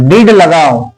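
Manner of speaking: teacher dictating to the class at x0.5; slow dictation. Acted. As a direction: read it out chunk by chunk for note-taking. Dictating the poem slowly.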